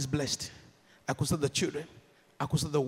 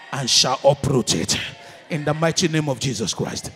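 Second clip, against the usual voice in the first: loud commanding proclamation; shouted declaration; 4 semitones lower, 9 dB louder than usual. Real, not acted. Preacher declaring over congregation, loud shouted proclamation. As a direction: thunder it out like a decree.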